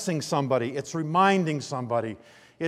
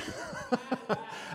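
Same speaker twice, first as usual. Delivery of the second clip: breathy laugh; 8 semitones higher, 9 dB quieter than usual. Real, not acted. Laughing at son's slip.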